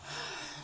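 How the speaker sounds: soft weary exhale; tired sigh. Real, not acted. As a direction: let out a worn-out breath. After sending son away.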